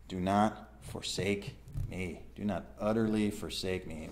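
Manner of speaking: humble plea. Acted. Preacher repeats the psalmist's plea to God, humbly. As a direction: plead quietly, giving each word room. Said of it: "Do you see the humility in that?".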